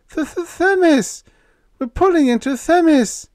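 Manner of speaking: high, loud, frantic stutter; panicked stammer. A narrator's reading. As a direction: blurt it out terrified. Panicked crewman stammers news.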